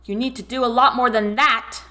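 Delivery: loud angry yell. A narrator's reading. Laurel yells at David from inside the room, loud angry. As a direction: hurl it across the room. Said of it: "yelled Laurel".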